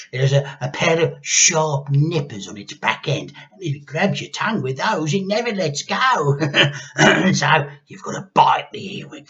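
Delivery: cackling hag. A narrator's reading. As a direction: relish every crunch. Grandma voice.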